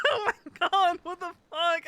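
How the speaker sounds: yelping in shock through laughter; shocked outcry. Real, not acted. Shocked by traumatizing sight.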